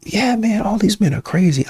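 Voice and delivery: put-on high girlfriend voice agreeing about men. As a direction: toss it off high like a friend who's heard it all. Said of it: "especially if they have a man".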